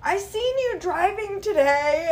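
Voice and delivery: high, slow eager fan voice; eager fan message. Retold or imitated. The driver's message read in a high eager voice. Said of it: "it was like".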